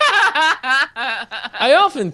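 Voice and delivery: high and slow, giggling through it; laughing. Real, not acted. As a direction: fight off the giggles.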